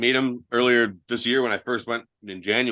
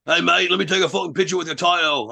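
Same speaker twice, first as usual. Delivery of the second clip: higher, lightly put-on British accent; British pub bloke; 7 semitones higher than usual. British pub guy asking for a photo, light mock accent.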